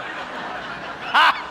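normal talking voice

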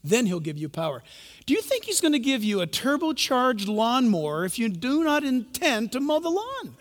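usual speaking voice

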